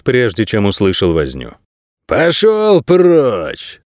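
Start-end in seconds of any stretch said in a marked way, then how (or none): 2.1-3.8 high, drawn-out angry dismissal; irritated bark. A narrator's reading. Room's occupant to whoever knocks, irritated bark. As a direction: send them away, stretching each word high. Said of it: "раздражённо рявкнул хозяин номера".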